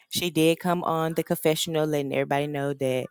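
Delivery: normal talking voice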